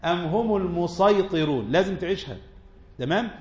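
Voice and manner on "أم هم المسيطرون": slow recited verse; Quran recitation. Acted. Teacher reciting a verse to students, slow chant.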